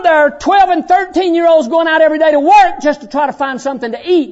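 high, loud preacher's exhortation; full-force preaching. Real, not acted. Preacher to kids on free room and board, full-force preaching. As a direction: press it home to the congregation.

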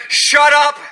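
loud, high command; sharp rebuke. Acted. Jesus turning on the ocean: loud sharp command. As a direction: bark it at the sea.

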